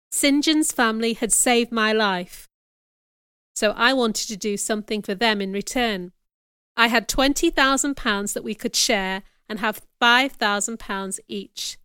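plain reading voice

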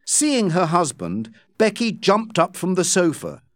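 ordinary delivery, nothing marked

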